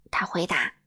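ordinary delivery

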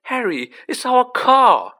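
excited yell climbing high; relieved shout. A narrator's reading. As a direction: shout the good news, overcome with relief. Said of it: "his voice breaking with relief".

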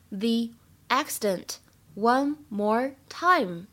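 slow, word by word, for learners; slow practice reading. Shown as a demonstration. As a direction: spell it out slowly so students can follow. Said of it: "今天我们来学这样一个句子".

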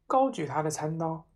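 ordinary delivery, nothing marked

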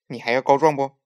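loud threatening demand; loud, menacing question; angry threat. A narrator's reading. Angry Nezha threatens with fist.

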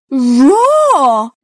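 put-on loud playful toddler roar; dinosaur roar. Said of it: "says George".

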